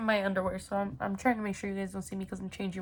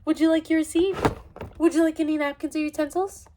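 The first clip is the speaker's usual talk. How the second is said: attentive cashier; 6 semitones higher, 6 dB louder than usual. Pretend. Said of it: "And then I go".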